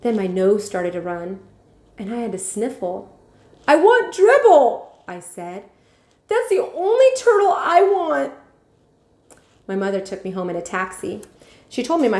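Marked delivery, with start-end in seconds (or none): 3.7-4.9 high, loud, slow and tearful; crying plea. Acted. Boy to mother and vet, crying and slow. Boy sniffling, wants his turtle. 6.3-8.3 high, loud, slow and tearful; crying plea. Acted. Boy to mother and vet, crying and slow.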